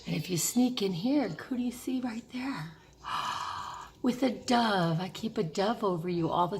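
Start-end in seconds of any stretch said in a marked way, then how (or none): 1.3-2.7 soft secretive whisper. Real, not acted. Sneaking a peek at a photo. 3.0-3.8 breathy gasp of delight; soft delighted gasp. Real, not acted. Delighted at the photo.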